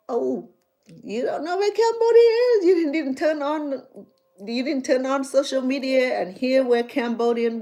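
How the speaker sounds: high, loud incredulous retort; indignant disbelief. Retold or imitated. Retelling her reply to the churchgoer, high and indignant. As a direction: act the shock that he never heard of Cambodia.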